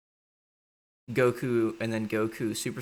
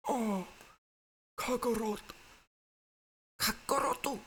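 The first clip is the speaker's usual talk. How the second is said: much higher, crying out; swooning Vegeta. Retold or imitated. Vegeta pining for Kakarot, high falsetto. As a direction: moan his name in falsetto. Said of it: "It's like".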